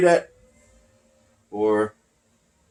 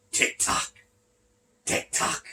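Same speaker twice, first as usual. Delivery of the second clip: soft unvoiced whisper of "Tick tock"; whispered catchphrase; 9 dB quieter, slower than usual. Quoting Killer Kross's signature line, whispered. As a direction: breathe "Tick tock" like a creeping threat. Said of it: "he always said".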